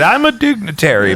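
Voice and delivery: put-on lightly Bosnian-accented dignitary; a hint of a Bosnian accent, self-important. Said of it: "Is that your Bosnian accent?".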